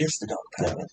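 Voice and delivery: hushed whisper, almost no voice. Real, not acted. Whispering to his co-host about the Dog Pound. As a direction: breathe it out under the breath.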